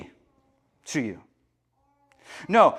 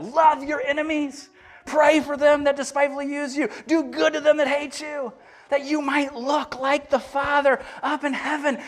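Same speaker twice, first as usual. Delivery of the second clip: pitched well up, lightly voiced Jesus laying down hard sayings; playful Jesus voice; 8 semitones higher than usual. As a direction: speak as Jesus, high and earnest. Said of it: "He's like".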